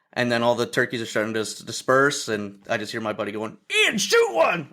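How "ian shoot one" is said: high-pitched imitation of an urgent call; urgent mock shout. Buddy calling to Ian to shoot, urgent high mock shout. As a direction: imitate a buddy urging him to fire.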